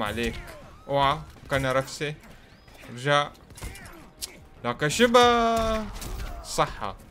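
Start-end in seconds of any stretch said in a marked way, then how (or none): 4.9-5.8 slow, high drawn-out astonishment; real amazement at the screen; surprised. Real, not acted. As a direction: stretch it out in disbelief. Stunned at game move.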